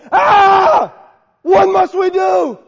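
high, loud desperate cry; shouted plea for help; crowd crying out. Retold or imitated. Crowd cries out to Peter.

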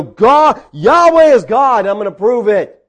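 high, loud defiant proclamation; defiant declaration. Acted. Elijah to his rivals, loud defiant boast. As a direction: declare it loudly as a dare. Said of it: "he's just sitting back mocking".

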